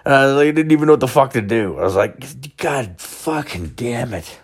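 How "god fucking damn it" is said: slow, soft despairing curse; despairing groan. Retold or imitated. Didn't know what to do.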